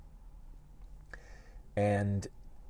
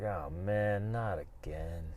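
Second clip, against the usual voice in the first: softer, slow, defeated; weary sigh; 4 semitones lower, 9 dB quieter, slower than usual. Retold or imitated. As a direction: let it sag with tiredness. Waking up wishing he hadn't.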